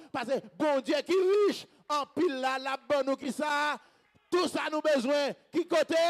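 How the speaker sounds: loud, emphatic congregational preaching; rousing preacher's call; full-force preaching. Real, not acted. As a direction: drive each phrase out to the crowd.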